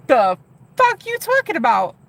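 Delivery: high, loud mock disbelief; mock incredulous. Streamer retelling her reaction to the caller, mock incredulous. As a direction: play up your stunned reply. Retold stunned reply to caller.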